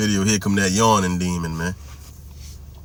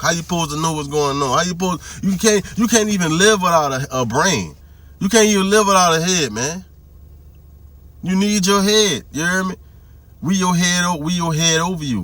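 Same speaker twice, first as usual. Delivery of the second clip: heated preaching; 6 semitones higher than usual. Real, not acted. Preaching about head.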